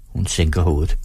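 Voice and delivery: usual speaking voice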